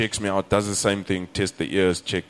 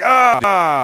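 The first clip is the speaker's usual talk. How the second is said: high, loud "ah" of put-on agony; hammed-up yelp of pain; faked pain scream; 12 semitones higher, 9 dB louder than usual. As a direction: cry out as if pressed where it hurts. Acts out faked stomach pain.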